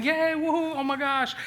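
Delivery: high, bright whooping cheer; cheering neighbors. Retold or imitated. Neighbors cheering as the car is pulled free, whooping high. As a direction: cheer like a crowd celebrating a rescue.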